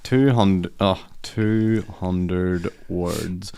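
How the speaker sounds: very slow, spelling it out while typing; slow dictation. Real, not acted. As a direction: drag out each word as you type. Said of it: "typing the prompt aloud".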